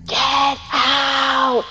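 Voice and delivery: high sudden yelled command; retold shout. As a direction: shout it like a furious ghost. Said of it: "they were just shouting".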